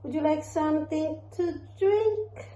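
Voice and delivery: high, slow cooing questions; drawn-out mommy voice. Real, not acted. Mother asks baby about feeding.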